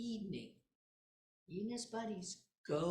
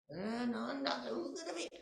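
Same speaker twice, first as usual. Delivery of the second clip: ordinary delivery, nothing marked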